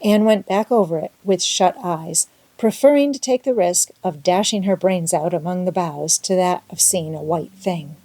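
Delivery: usual speaking voice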